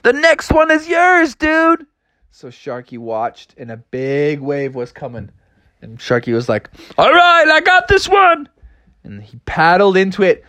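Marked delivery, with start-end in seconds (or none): 0.0-1.9 high, drawn-out surfer holler; calling out. Acted. Frogs to shark about next wave, high holler. 7.0-8.5 high, loud cartoon confidence; eager surfer shout. Shark taking his wave, excited high shout.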